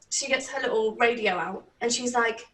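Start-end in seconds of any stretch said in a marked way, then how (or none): none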